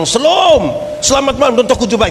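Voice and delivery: hammed-up friendly pastor voice; pastor's greeting. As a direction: greet the house like a cheerful pastor. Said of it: "Tiba-tiba pendeta bilang".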